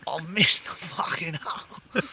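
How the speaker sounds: put-on slow, whispery drunk Aussie swearing; drunk Australian woman. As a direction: slur it like a tipsy Australian woman with a cigarette in her mouth.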